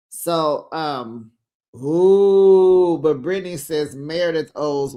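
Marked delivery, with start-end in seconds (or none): 1.7-3.0 loud, sustained ooh; long ooh. Real, not acted. Reacting as Brittany weighs in, a long loud ooh. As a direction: hold a big "ooh" over the drama.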